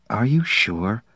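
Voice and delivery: soft, mildly nervous question. A narrator's reading. Pat doubting they can dismiss the trader, nervous. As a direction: ask it quietly, with a touch of worry. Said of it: "Pat asked nervously".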